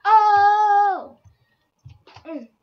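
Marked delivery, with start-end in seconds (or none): 0.0-1.2 long, high, loud "oh"; loud outcry. Real, not acted. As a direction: cry out at the game, drawing it out. Cries out during game play.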